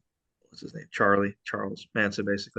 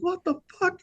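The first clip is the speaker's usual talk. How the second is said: way higher, startled squeal; shocked outburst. Retold or imitated. DiCaprio in the pool, hearing the chaos, shocked high yelp. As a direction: yelp it in disbelief. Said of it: "he's like".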